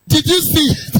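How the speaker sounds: loud, high told-you-so; pointed told-you-so call; teasing reproach. Retold or imitated. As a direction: rub it in, voice up. Husband reproaching wife after counselling.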